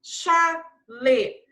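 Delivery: "Chalé" loud, split sha-lé; CH sound. Shown as a demonstration. Teacher confirming CH to pupils, "Chalé" in clear syllables. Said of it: "Som de C H".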